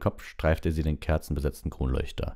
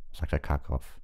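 usual speaking voice

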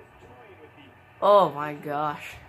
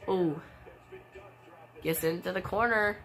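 usual speaking voice

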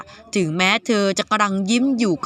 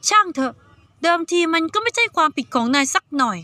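put-on higher young girl's voice, puzzled; 6 semitones higher than usual. Saiyaka questioning the boy, in a higher girl's voice. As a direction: voice Saiyaka as a suspicious teenage girl. Girl character brushing it off.

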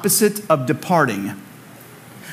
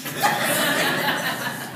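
breathy laugh; 9 semitones higher, 7 dB quieter than usual. Real, not acted. Laughs at own joke.

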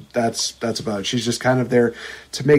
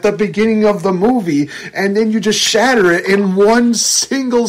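outraged rant; 7 semitones higher than usual. Real, not acted. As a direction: fume at the film in disbelief.

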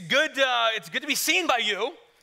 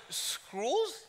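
higher, softer British query; puzzled British echo, rising; British accent; 5 semitones higher, 7 dB quieter than usual. Retold or imitated. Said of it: "She's British".

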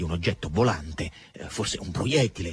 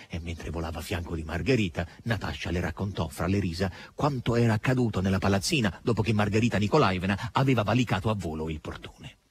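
plain reading voice

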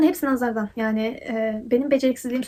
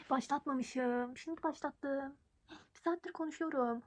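soft, slow off-camera aside; quiet aside; 16 dB quieter, slower than usual. Real, not acted. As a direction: mumble to yourself, laughing. Forgot to record, quiet aside.

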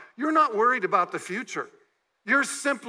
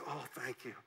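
mock thanks; 7 semitones lower, 17 dB quieter than usual. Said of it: "I don't think she said".

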